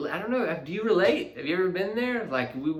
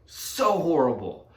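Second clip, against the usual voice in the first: remorseful; 5 semitones higher, 5 dB louder, slower than usual. Real, not acted. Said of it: "I know".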